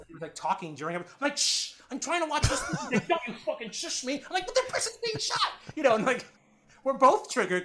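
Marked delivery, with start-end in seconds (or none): 1.4-2.6 high-pitched mock shush; annoyed shushing. Girlfriend shushes partner during documentary, annoyed. 3.1-4.2 indignant retort. Retold or imitated. Acted retort to being shushed. 4.4-5.5 very high-pitched retold protest; exasperated protest. Girlfriend argues back over documentary, exasperated.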